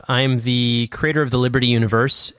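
normal talking voice

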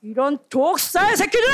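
John the Baptist's rebuke climbing in pitch; fiery rebuke. Acted. John the Baptist denouncing the religious leaders, fiery rebuke. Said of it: "뭐라고 말해?".

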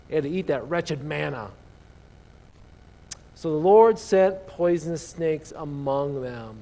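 normal talking voice

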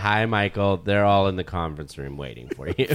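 lightly voiced low receptionist; low, understated quoted greeting; imitating Rolando. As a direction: drop low for a receptionist's reply. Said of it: "Rolando's like".

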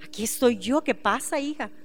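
soft, low fatherly comfort; tender reassurance. Acted. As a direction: gently console a child, trailing very soft. God comforting a daughter.

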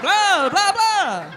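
high, playful vampire noises; mock vampire. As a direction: join in with a hammy vampire blah. Joins in the mock vampire bit.

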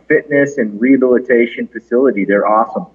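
ordinary talk, nothing marked

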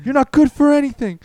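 high, loud imitation of self-berating; mock self-loathing yell. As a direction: scream at yourself in the mirror, hammed-up. Acts man berating his mirror.

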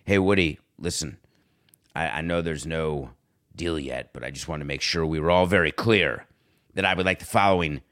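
low, confidential caller voice; hushed and deep, mock-important; Aaron Rodgers on the phone. Aaron giving demands to Woody, low and confidential. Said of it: "I bet he talks like this".

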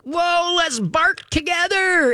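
very high, drawn-out gleeful howl; excited dog voice. Acted. Dog joining in with the yelling owner, mock excited howl. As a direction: join the frenzy as a hyped-up dog. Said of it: "And the dog's like".

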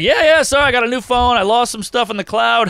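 high, hurried apology; eager excuse. Retold or imitated. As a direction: rush the excuse out. Excuse to Seinfeld.

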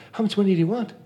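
high, bright, keen questions; eager offer. Retold or imitated. Husband offering Corey a car, eagerly helpful. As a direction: jump in eagerly to help.

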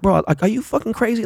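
high-pitched incredulity; retold disbelief. Fan reacting to a player's threat, incredulous. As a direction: question him with pitch lifted high.

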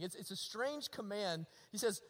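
ordinary talk, nothing marked

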